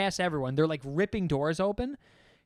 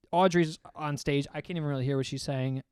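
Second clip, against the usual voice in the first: ordinary delivery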